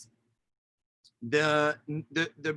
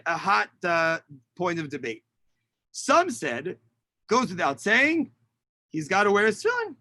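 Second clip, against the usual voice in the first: usual speaking voice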